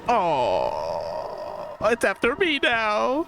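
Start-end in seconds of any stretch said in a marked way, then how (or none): 1.8-3.2 high, slow, frightened; fearful whine while fleeing; panicked. Real, not acted. Being chased by monster.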